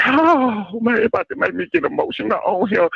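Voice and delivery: high voice, quick and emotional; high put-on voice. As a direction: stay up in the squeaky caller voice. Same put-on voice continues.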